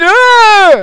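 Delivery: sharp loud cry as he falls; sudden high yelp of surprise; startled yelp. Acted. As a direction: yelp as you hit the ground. Thrown off by his ram.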